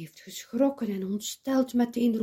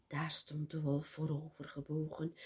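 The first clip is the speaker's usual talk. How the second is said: usual speaking voice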